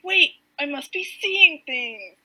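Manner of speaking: loud, slow double take; startled disbelief. Retold or imitated. Hero spotting someone at the docks, acted startled disbelief.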